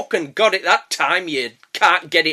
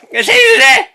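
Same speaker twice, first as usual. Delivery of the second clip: much higher, louder, laughing through it; giggly sing-song farewell; 8 dB louder, faster than usual. Shown as a demonstration. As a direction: toss off the goodbye with a giggle. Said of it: "repeated farewell, second take sillier".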